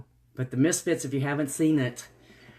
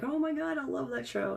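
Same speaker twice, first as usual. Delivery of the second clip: gushing friend; 12 semitones higher, 4 dB quieter than usual. Retold or imitated. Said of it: "a friend of mine was like".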